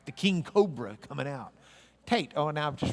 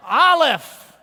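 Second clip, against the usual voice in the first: high, loud proclaimed letter name; loud announced answer; 11 semitones higher, 14 dB louder than usual. Acted. Teacher revealing each letter to the class, loud and high. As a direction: boom it out like a quiz reveal.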